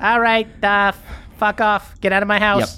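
put-on high, bratty teenage kid talking back; mouthy teen. Teen kid talks back to his mom, high and bratty.

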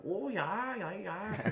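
high, drawn-out Wisconsin "Oh, yeah" teasing; mock Wisconsin accent. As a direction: mimic the Wisconsin accent the kids made fun of. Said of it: "mocked the shit out of me for how I spoke".

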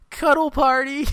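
high, loud gleeful squeal; high excited cuddle party; quoting Dory. Retold or imitated. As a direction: squeal it like a delighted fish. Dory's line quoted from the film.

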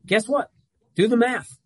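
punchy guess what, do the math; emphatic retort. Real, not acted. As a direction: snap it back at them. Challenging insulted teams.

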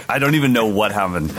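ordinary talk, nothing marked

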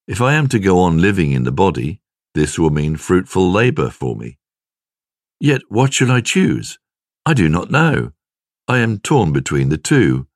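ordinary delivery

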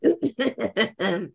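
choking cough on wine. Real, not acted. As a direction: cough after wine goes down wrong. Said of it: "I almost just choked on my wine".

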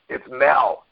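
lightly imitated grumbly caller; a touch of rocky growl. Former boss naming himself on the phone, lightly grumbly. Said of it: "it was a real grumbly, rocky voice".

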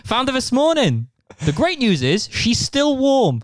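high, lilting Pontypridd voice; Welsh accent. Retold or imitated. Neighbour breaking news about the cat, high Welsh accent. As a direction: slip back into a high Fireman Sam Welsh accent. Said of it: "a Fireman Sam, Pontypridd".

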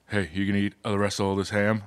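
low, soft, quick British musician voice. Acted. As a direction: murmur it low with a British accent. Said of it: "[name] sounds a lot like [name]".

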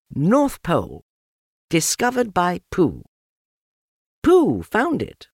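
put-on high, proud little boy announcing; high, proud child's voice; young Christopher Robin. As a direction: read the sign out proudly like a small boy. Christopher Robin's message read out.

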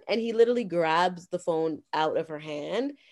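ordinary talk, nothing marked

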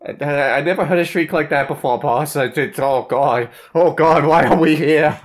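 loud, high frightened pleading; scared. Acted. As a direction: whine in fear to go home.

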